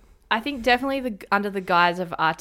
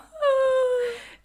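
high laugh; 3 dB louder than usual. Real, not acted. Laughing at sonar joke.

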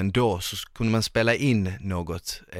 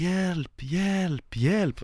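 cry for help; 10 semitones higher, much slower than usual. Retold or imitated. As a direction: imitate a drowning friend calling out. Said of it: "hör jag så här". (högt, panikslaget rop på hjälp)

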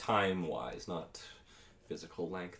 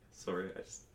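mock choked up. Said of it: "I just need a moment".

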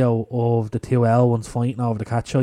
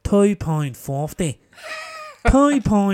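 slow Northern Irish radio catchphrase, building louder; Northern Irish accent; 7 semitones higher, 5 dB louder, slower than usual. Shown as a demonstration. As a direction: draw out the vowels in Northern Irish. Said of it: "Northern Irish accent".

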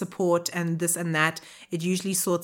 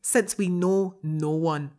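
put-on wobbly Scottish-sounding Irish accent, cracking up; attempted Irish accent. Co-host struggling through the listener's letter, attempted Irish accent. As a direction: keep the put-on brogue while a laugh breaks in. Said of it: "I was going for Irish".

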